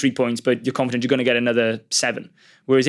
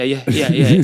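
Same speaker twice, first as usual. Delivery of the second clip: louder yeses breaking into laughter; laughing yeses; 6 dB louder, slower than usual. Retold or imitated. Player answering the coach's call, laughing loudly. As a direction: gush agreement through a laugh. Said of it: "And I'm like".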